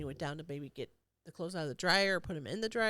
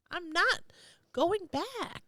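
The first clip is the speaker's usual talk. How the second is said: mock defiance; 9 semitones higher, much slower than usual.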